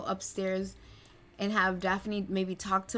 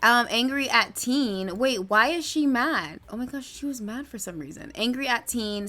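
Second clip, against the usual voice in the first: usual speaking voice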